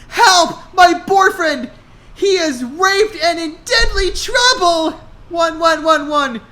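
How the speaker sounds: high loud panicked shouting; frantic cry for help. Acted. Hannah cries for help.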